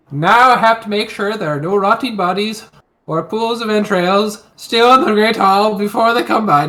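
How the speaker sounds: lofty singsong bard voice; grand rhythmic bard. Acted. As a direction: declaim it like a storyteller bard. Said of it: "Now my Bard voice came out".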